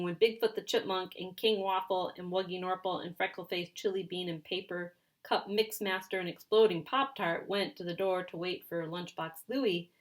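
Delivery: ordinary delivery, nothing marked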